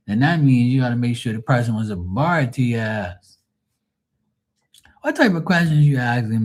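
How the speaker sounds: loud, high mock scolding; exasperated rant. Real, not acted. Ranting at Lisa over three questions in one, loud and exasperated. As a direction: scold her like a fed-up friend.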